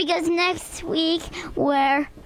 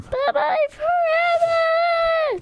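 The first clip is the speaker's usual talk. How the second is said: slow, high drawn-out yell; yelled goodbye; 14 semitones higher, much slower than usual. Real, not acted. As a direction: belt out a dramatic farewell. Child yells goodbye dramatically.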